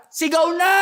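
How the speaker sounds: high shout climbing at the end; shouted command. Acted. Preacher voices the Lord ordering the people, shouting. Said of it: "pag sinabi ng Lord na".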